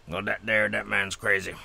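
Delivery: ordinary delivery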